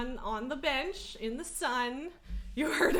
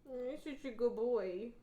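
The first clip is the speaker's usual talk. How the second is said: slow, softer pet praise; slow soft cooing; 4 dB quieter than usual. Real, not acted. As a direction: coo it slowly to the cat. Cooing about the cat.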